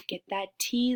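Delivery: normal talking voice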